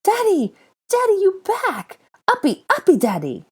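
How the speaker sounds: put-on very high, loud pleading child; excited toddler. A narrator's reading. Small boy clinging to his father's legs, high and thrilled. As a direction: beg to be picked up, bouncing with joy.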